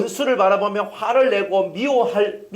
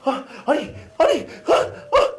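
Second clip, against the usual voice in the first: mock gorilla huffing, rising higher and higher; snorting gorilla; 7 semitones higher, much slower than usual. Preacher mimicking an angry person as a huffing gorilla. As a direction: huff like a mock gorilla blowing smoke. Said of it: "연기 토해내면서 진짜 막 얘기하는".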